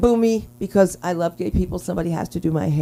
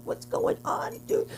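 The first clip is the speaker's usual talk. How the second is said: a soft, lightly put-on dude voice; 11 dB quieter than usual. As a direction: purr it like a flirting dude. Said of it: "It's like".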